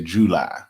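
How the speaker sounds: "July" as JOO-LIE, both syllables full; "July" in two distinct syllables. Shown as a demonstration. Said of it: "We say July".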